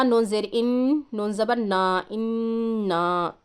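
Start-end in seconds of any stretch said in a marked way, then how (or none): 2.1-3.3 इन्ना with the doubled न held a while in the nose; nasal ghunna. Shown as a demonstration. Teacher shows the ghunna held on इन्ना. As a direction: hum the नन of इन्ना through the nose. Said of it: "नाक में गुन्ना करना है".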